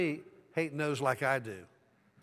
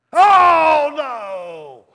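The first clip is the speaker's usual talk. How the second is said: put-on high, loud childish wail, oh no; mock wail of dread; 11 semitones higher, 17 dB louder than usual. Boy dreading his grandmother's whipping, high childish wail.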